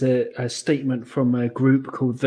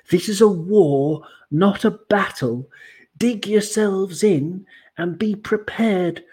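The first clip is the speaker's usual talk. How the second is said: mock-dramatic reading; 6 semitones higher, slower than usual. Reading out fan group statement.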